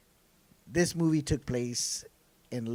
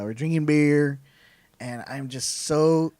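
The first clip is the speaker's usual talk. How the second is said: loud, drawn-out country twang; strong country drawl; country accent; 3 semitones higher, 7 dB louder, slower than usual. Real, not acted. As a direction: sound really country, slow and loud. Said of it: "You sounded so country right now".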